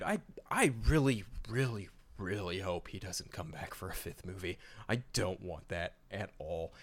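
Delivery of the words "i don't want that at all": usual speaking voice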